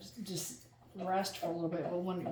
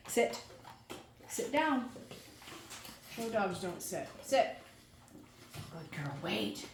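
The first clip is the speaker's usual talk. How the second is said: firm dog commands; 5 dB quieter, slower than usual. Real, not acted.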